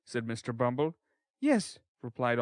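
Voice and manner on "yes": put-on boy's answer. A narrator's reading. Oliver replying.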